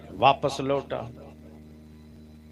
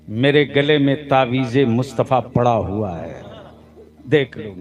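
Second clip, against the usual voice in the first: preaching; 4 semitones lower, 4 dB louder than usual. Acted. Preacher proclaiming to crowd.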